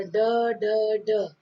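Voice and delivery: "ड" sounded alone three times, with gaps between; isolated D sound. Shown as a demonstration. Teacher counting the alliteration for students, isolated repeated D. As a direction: tap out each "ड" as a separate sound. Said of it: "जैसे a dark and dangerous day".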